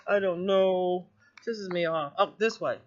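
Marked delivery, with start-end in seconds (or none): none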